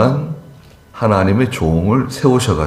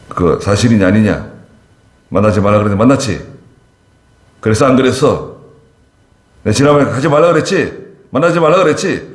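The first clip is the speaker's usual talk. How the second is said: building from firm to higher, louder scolding; rising rebuke; 3 semitones higher, 3 dB louder than usual. Retold or imitated. Senior pastor confronting a member, scolding builds louder. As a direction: press harder with each question. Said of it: "점점, 점점 소리가 이제 올라갑니다".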